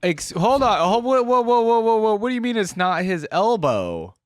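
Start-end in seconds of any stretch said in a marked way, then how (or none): none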